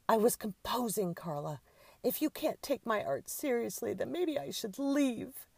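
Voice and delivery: high, loud, wounded diva; imperious huff. A narrator's reading. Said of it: "glowered imperiously".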